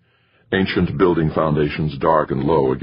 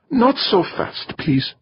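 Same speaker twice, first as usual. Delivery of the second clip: put-on high, slow Middle European accent; slower than usual. A narrator's reading. Astronaut stopping a hurried colleague, Middle European accent. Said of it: "in her Middle European accent".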